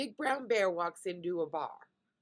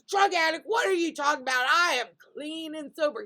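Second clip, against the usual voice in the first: loud, high mock outrage; hammed-up protest of innocence; indignant; 8 semitones higher, 8 dB louder than usual. Joke's bear accused of being a drug addict, indignant.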